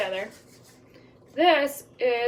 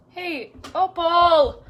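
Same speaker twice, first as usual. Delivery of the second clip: calling out; 5 semitones higher, 6 dB louder, much slower than usual. Real, not acted. Calling toddler under table.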